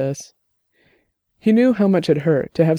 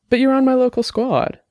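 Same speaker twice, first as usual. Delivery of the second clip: higher-pitched, puzzled objection; confused; 9 semitones higher than usual. A narrator's reading. Dex puzzled that Annabelle is on his squad, confused. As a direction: lift the pitch in bewilderment.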